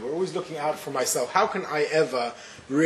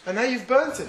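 lightly voiced little girl, higher and hurt; a hint of a tearful little girl; upset little girl; 8 semitones higher than usual. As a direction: play the daughter accusing her brother. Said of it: "And the little girl says".

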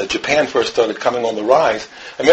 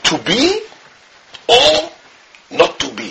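Japanese man; 9 semitones higher, slower than usual. Retold or imitated. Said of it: "the Japanese guy says".

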